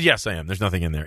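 low, dry imitation of the doctor; deadpan. Doctor admitting the joke to the patient, deadpan. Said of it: "he was deadpan serious".

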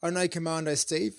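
usual speaking voice

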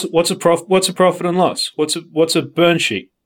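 ordinary talk, nothing marked

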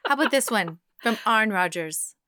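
ordinary talk, nothing marked

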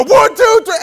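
high, loud rallying count; hooligan-style scream. Retold or imitated. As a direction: yell the count to set off a crowd. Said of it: "And we just scream".

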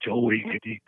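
normal talking voice

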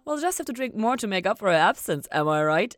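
high, loud and bright toast; cheerfully rally the table; brightly. A narrator's reading. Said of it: "Ella said brightly".

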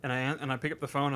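ordinary talk

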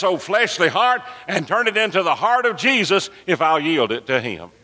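high, fervent pulpit cry; full-force preaching. Real, not acted. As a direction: preach it with rising fervor at a high pitch.

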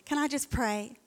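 ordinary talk, nothing marked

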